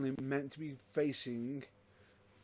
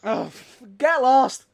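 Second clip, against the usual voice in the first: high, loud mock outburst; mock outrage; 9 semitones higher, 15 dB louder, slower than usual. Teasing his co-host, a loud mock outburst. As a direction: yell it in playful outrage.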